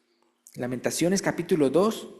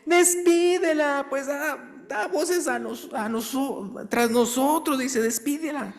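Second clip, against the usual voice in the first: high, slow, urgent plea; pleading disciples; 11 semitones higher, slower than usual. Retold or imitated. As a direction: beg him to send her away. Disciples begging Jesus. (los discípulos ruegan con urgencia)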